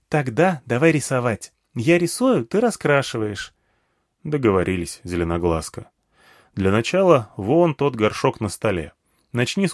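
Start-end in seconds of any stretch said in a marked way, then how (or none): 0.1-3.5 put-on higher, keen child voice; lightly pitched-up little girl. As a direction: propose it like an eager small girl. Girl proposes drawing together.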